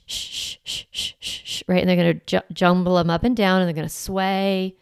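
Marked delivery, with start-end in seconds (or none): 0.0-1.8 soft unvoiced shh, shh like soothing a newborn; shushing a baby. Shown as a demonstration. Mom shushing her crying newborn, soft rhythmic shh. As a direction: hush it gently, breath only. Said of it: "you know, shh".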